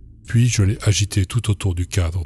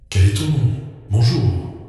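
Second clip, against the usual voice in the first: creature's eerie call; 4 semitones higher than usual. Acted. The creature knocking and asking.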